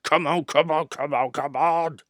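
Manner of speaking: loud, slowing effortful puffing; straining. A narrator's reading. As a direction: strain hard up the hill. Engine straining uphill.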